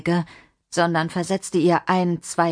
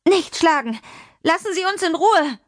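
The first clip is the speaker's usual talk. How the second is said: put-on much higher, desperate child's plea; frightened child's cry; 11 semitones higher than usual. Adriana defending Mimi from the man, frightened and angry. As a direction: cry out high to stop him.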